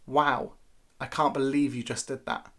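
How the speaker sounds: dry disapproval, voice dropping; unimpressed wow. Shown as a demonstration. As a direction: say it like a telling-off. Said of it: "notice the difference in emphasis".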